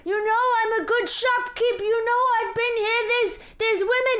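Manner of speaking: put-on high-pitched outraged old shopkeep; frantic pleading. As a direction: cry out to the neighbours for support. Shopkeeper screams appeals to neighbours.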